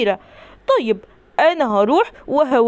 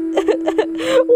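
mock sob up high; high whimpering sob; 8 semitones higher than usual. Teddy bear on his birthday, a high mock sob. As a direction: whimper like a sad toy bear. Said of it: "انت بتعيط".

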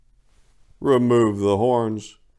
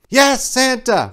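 much higher, slow reindeer reply; hasty chorus; 6 dB louder, slower than usual. A narrator's reading. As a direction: answer nervously as a herd. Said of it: "replied the seven assembled reindeer hastily".

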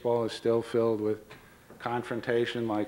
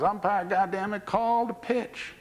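mock yell; 8 semitones higher than usual. Catcher to rookie umpire who won't call, high mock yell. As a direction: demand it as a fed-up catcher, stretching the last words. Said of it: "now Etcheverron yells".

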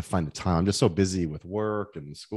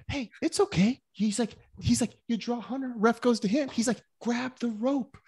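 much higher put-on voice, patiently explaining; Flair explaining kindly; 13 semitones higher than usual. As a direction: imitate Flair walking him through it, pitched way up. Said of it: "Flair's like".

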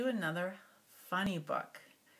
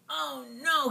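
growled; 6 semitones higher, 4 dB louder than usual. Acted. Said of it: "he growled".

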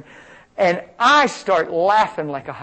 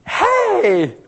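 very high, loud shout; calling out; 7 dB louder than usual. Retold or imitated. Preacher spotting mom and daughter mid-sermon, calling out. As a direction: holler a surprised greeting across a room.

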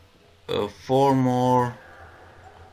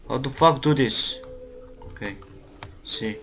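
usual speaking voice

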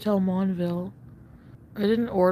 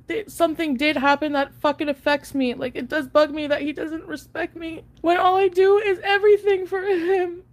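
crying out the hurt, pitch climbing; 9 semitones higher, 4 dB louder than usual. Real, not acted. Telling her viewers how he treats her, crying and breaking. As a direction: voice high and breaking into tears.

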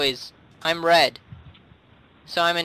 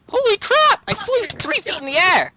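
high, excited exclamation; amazed; 13 semitones higher than usual. Real, not acted. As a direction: exclaim in astonishment, voice way up. Amazed at being thrown in game.

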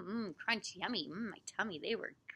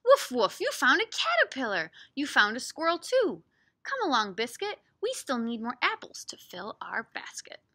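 loud, swooping storybook read; animated dialogue for small listeners; lively picture-book voice; reading to children; 2 semitones higher, 7 dB louder than usual. Acted. Reads picture book aloud.

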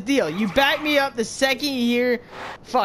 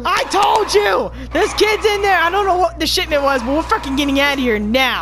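frantic raised-voice outburst; alarmed shouting; 2 semitones higher, 6 dB louder than usual. Real, not acted. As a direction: yell it urgently to get everyone moving. Alarm while fleeing.

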